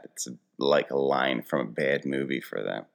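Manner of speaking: ordinary delivery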